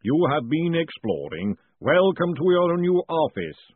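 big, slow golem voice swelling at the end; Mister Pump. A narrator's reading. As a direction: boom out a ponderous welcome. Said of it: "boomed the voice of Mister Pump".

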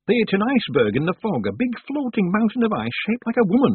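high, loud tale-telling villager; gossiping village woman. A narrator's reading. Woman telling Tiffany of the iceberg, high and excited. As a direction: pitch it right up, relishing the tale.

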